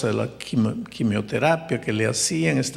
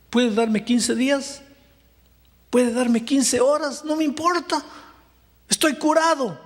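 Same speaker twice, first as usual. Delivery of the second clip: triumphant testimony; 6 semitones higher than usual. Acted. Doctor's declaration of faith.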